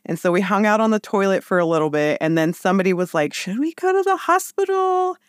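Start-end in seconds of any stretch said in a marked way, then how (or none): 3.3-5.2 pitched way up, asking; high questioning voice. Retold or imitated. Someone asks during labor.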